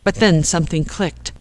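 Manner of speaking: ordinary delivery, nothing marked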